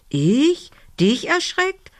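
high, rising incredulous questions; little girl's high-voiced disbelief; indignant surprise. A narrator's reading. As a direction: throw the accusation back, pitched high. Girl indignantly throws the accusation back.

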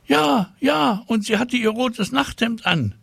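eager classmate piling on; another child chiming in. A narrator's reading. Child joins in tattling. (aufgeregtes Kind, viel höher)

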